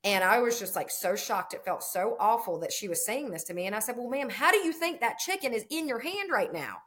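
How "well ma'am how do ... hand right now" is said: higher-pitched pointed question; incredulous retort. Retold or imitated. Farmer answers the shopper holding chicken, incredulous. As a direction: retell it as an exasperated, polite challenge.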